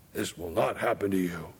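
low, quiet, odd voice for Peter; low, hushed Peter. Retold or imitated. Peter telling Jesus it won't happen, low and hushed. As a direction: mutter it low, deep and quiet.

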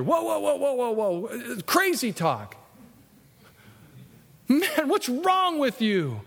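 high, slow, alarmed protest; alarmed Peter. Retold or imitated. Peter protesting to Jesus, high and alarmed.

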